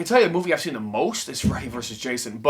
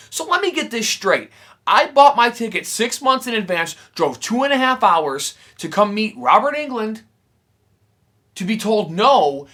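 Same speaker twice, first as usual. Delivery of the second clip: higher and louder outraged venting; heated rant; 6 semitones higher, 6 dB louder than usual. Real, not acted. Vlogger venting about the autograph line, higher and louder rant. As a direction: let the grievance boil over. Ranting about being turned away.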